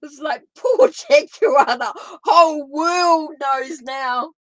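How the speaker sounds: loud, drawn-out words through real laughter; can't stop laughing. Real, not acted. Reader teasing Jaguar about the world knowing, laughing. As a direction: wail it out, cracking up. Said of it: "Can't stop laughing about it".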